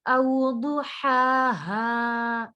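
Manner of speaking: slow, drawn-out tajweed recitation; Quran recitation. Shown as a demonstration. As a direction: recite it with ghunna on the noon. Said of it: "औ, not ऊ". (धीमी तजवीद वाली तिलावत)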